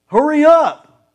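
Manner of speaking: loud, high, slow impatient order; harsh "Hurry up" drawn out, loud and high; impatient snapping tone. Shown as a demonstration. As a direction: snap it like a nagging spouse. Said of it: "It's just tone".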